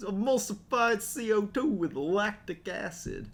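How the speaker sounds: hammed-up smooth flirting; smooth-talking Spike. Smooth pickup line as Spike.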